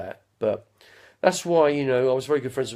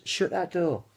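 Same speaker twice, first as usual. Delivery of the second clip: higher, lighter camp line; a lighter camp retry; Larry Grayson catchphrase; 6 semitones higher, slower than usual. Pretend. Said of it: "that was Larry Grayson".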